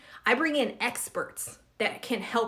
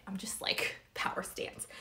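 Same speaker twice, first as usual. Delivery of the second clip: soft unvoiced whisper; whispered aside; 2 semitones lower, 10 dB quieter than usual. Real, not acted. To viewers, noticing her raised leg: whispered aside. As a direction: let it slip out under your breath. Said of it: "whispered aside about her stance".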